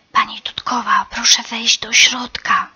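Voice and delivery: chameleon whispering to the mother bird. A narrator's reading. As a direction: breathe it so the enemy won't hear. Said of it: "szepnął kameleon".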